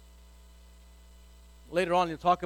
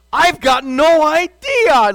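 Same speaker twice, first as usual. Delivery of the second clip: higher, louder, slow fake innocence; feigned innocence; 6 semitones higher, 10 dB louder, slower than usual. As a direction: play dumb, drawn out and loud. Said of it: "lying through your teeth".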